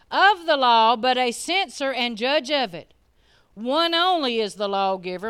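loud, slow, deliberate; hammering the verse home slowly; slow preached scripture. Acted. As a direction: lean on every word.

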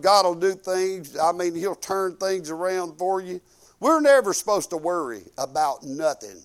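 ordinary talk, nothing marked

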